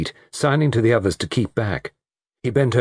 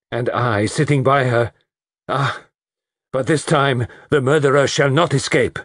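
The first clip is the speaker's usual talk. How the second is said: higher, impassioned resolve; vehement vow; 6 semitones higher, 3 dB louder than usual. Acted. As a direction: build into a forceful vow. Poirot vows to catch the murderer.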